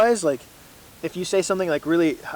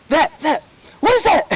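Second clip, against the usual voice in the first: much higher, puzzled mock voice of the dad; imitating dad; 10 semitones higher, 5 dB louder than usual. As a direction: play the confused dad, voice pitched up. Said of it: "and he'll be like".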